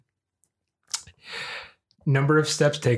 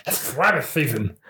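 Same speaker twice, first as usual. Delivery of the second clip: Daffy Duck voice; slower than usual. Retold or imitated. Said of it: "Daffy Duck and Bugs Bunny".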